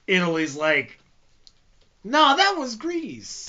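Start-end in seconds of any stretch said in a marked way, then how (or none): 2.0-3.5 high, drawn-out mock Italian accent; hammed-up Italian accent, slow and high; attempted Italian accent. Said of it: "I can't do an Italian accent".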